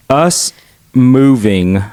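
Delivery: normal talking voice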